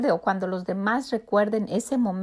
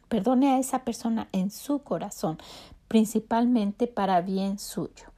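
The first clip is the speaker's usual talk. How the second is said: ordinary delivery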